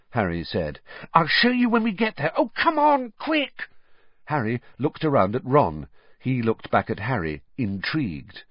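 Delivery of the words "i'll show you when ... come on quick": put-on high, impatient girl voice; eager Hermione. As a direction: hurry them along, bubbling over. Hermione hurrying them along.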